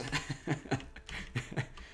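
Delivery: breathy airy giggle; amused soft chuckle; soft laugh. Real, not acted. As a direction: let out a quiet laugh. Laughs at the banners.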